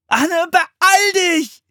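slow, high, drawn-out shout; helpless call. A narrator's reading. Philipp begging his sister to hurry, helpless shout. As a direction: call out helplessly, stretching the words. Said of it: "rief Philipp hilflos".

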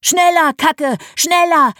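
high, loud cheering, slow and drawn out on schneller; cheering shout. A narrator's reading. Dad and toddler cheering the poo downstream, loud high cheer. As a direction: urge the racer on, full voice.

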